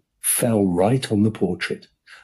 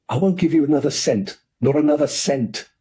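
furious uncle; 7 semitones higher than usual. Acted. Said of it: "turning violently on Corky".

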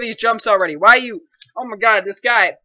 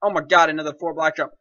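ordinary delivery

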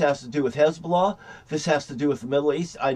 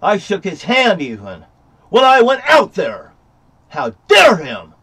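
much louder, higher outraged yell; furious; 6 semitones higher, 8 dB louder than usual. Real, not acted. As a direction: explode at the sheriff. Furious at the sheriff.

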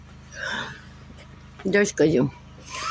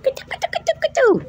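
very high sing-song call; high calling coo; faster than usual. Acted. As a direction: call the kitten in a squeak. (высокий зов котёнка)

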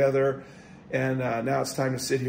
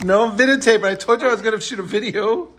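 raised voice, calling back through the house; yelling across the room; 6 semitones higher, 6 dB louder, faster than usual. Real, not acted. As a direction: call out to someone in another room. Answering wife calling from another room.

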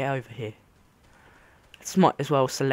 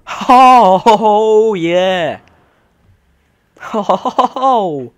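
loud, delighted exclamations; thrilled to be floating; excited; 6 semitones higher, 13 dB louder, much slower than usual. Real, not acted.

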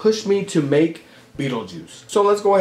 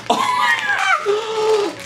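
very high, gasped oh my God; gasping delight; much slower than usual. Real, not acted. Spotting candy from a fan, a high gasp. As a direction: squeal it out in disbelief. Seeing candy she wanted.

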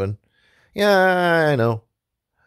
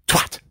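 swatter smack. Acted. Fly swatter sound.

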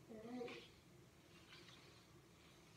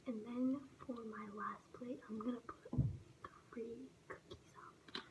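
whispering; 9 dB quieter than usual. Real, not acted. Whispering while prepping plates.

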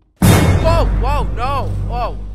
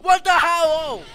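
startled shout; 14 semitones higher, 11 dB louder than usual. Real, not acted. Startled in horror game.

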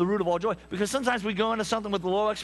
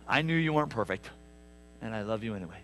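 tender; 9 semitones lower, 4 dB quieter, faster than usual. Acted. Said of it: "gonna go".

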